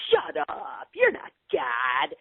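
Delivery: high, indignant retort, slowed on "You're not God"; brash dismissal. Retold or imitated. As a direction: brush off the voice as if calling a bluff. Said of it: "that was the energy of it".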